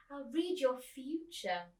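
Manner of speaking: lightly voiced old lady. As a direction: say it slowly, correcting yourself. Corrected line, old lady voice.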